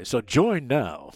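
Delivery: loud hard-sell pitch; commercial voice. Pretend. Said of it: "I put on that commercial voice".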